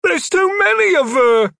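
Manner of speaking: very high, loud panicked shout; panicked cry. A narrator's reading. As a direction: scream it in terror. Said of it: "Heelkick cried".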